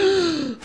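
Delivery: quick high startled gasp; sharp gasp. Real, not acted. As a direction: catch your breath in sudden fright. Susto com inimigo no jogo. (susto, suspiro agudo e rápido)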